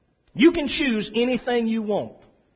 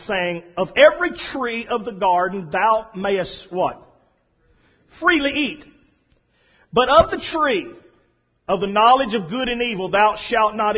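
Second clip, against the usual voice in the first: scripture reading; 2 semitones higher, 3 dB louder than usual. Acted. Reads Genesis aloud.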